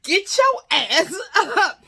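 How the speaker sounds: high, slow, loud holler; shouted wake-up order. Retold or imitated. Woman waking her snoring guest, shouted wake-up order. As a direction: rouse a dead sleeper, stretching each word.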